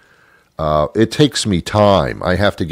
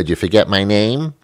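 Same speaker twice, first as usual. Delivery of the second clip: ordinary talk, nothing marked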